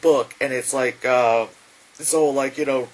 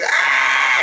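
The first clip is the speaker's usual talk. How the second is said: loud held screech; acted agonised scream; mock scream; 6 dB louder than usual. Imitating screams.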